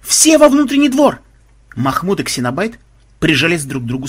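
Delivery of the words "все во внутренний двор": high, loud panicked order; frantic shouted alarm; terrified yell. A narrator's reading. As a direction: scream for reinforcements. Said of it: "В полном ужасе заорали".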